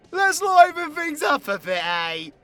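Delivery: high, loud, sudden burst of cheer; rowdy livening-up. Acted. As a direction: shout it out to get the party going. Livening up with drinking game.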